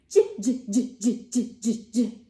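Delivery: j repeated as a bouncy jiggling sound; j, j, j like a laughing jellyfish; J sound for kids; letter J sound. Shown as a demonstration. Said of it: "which is the sound of the letter J".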